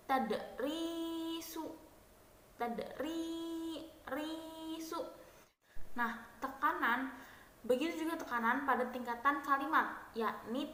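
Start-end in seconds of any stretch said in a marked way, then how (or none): 0.1-5.0 Tadrisu very slow and high, risu stretched; stress on last syllable. Shown as a demonstration. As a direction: draw Tadrisu out, landing on risu. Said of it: "terdapat pada suku kata akhir". (tekanan pada suku kata akhir, lambat)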